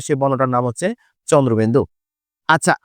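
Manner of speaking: ordinary talk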